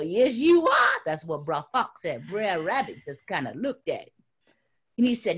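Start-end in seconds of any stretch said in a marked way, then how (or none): 0.0-1.0 gloating. Acted. Fox gloating.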